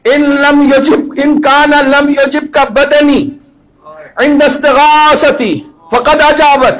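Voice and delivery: high, loud impassioned address to the Imam; full-force preaching. Real, not acted. Preacher speaking to his master Hussain, impassioned and loud.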